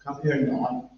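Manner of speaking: parent's command in a Belfast accent. Shown as a demonstration. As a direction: order a kid over in Belfast tones. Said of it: "Send him the Belfast accent".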